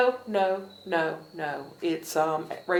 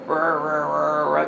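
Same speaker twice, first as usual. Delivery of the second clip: low, loud vroom of a truck engine revved hard; mock truck engine roar; imitated engine revving; 6 semitones lower, 9 dB louder, slower than usual. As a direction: rev it up with your voice, loud and low. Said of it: "revving everything up".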